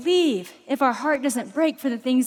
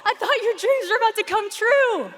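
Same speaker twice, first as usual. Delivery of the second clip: baffled dad; 9 semitones higher than usual. Retold or imitated. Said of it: "I'm like".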